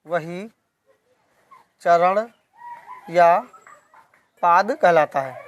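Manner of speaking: slow, with a long pause after each word; dictation pace. Shown as a demonstration. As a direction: dictate it for students writing it down. (धीरे-धीरे, शब्द-शब्द लिखवाते हुए)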